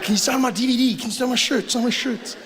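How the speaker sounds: high-pitched pleading autograph hunter; starstruck fan. Acted. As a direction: beg eagerly for a signature. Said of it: "You'll be like".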